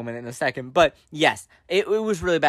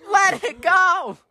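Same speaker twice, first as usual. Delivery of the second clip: high, drawn-out squealing plea; hammed-up high-pitched meltdown; mock exasperation; 14 semitones higher, slower than usual. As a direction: wail it in mock despair, stretching each word. Exasperated mock outburst at co-host.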